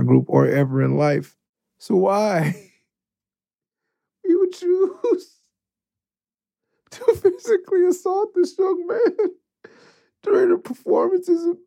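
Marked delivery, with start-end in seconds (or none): 1.8-11.6 high, cracking with laughter; trying not to crack up while telling it; giggling through the story; laughing disbelief. Real, not acted. Laughing while recounting prank.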